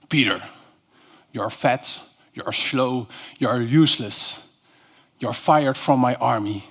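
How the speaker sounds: lightly voiced commander, a touch low; understated imitation of a commander firing a soldier; imitated commander. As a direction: quote a commander's dismissal in measured short phrases. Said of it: "He's our commander".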